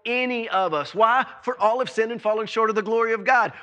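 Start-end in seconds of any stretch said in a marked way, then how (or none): 1.4-3.5 fast, tossed-off verse; rattled off. Real, not acted. Preacher quickly quotes scripture to the church, rushed. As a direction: race through the familiar verse.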